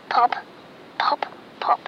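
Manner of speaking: put-on hushed popping sounds for a child; whispered cork pops. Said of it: "He can sound like a cork".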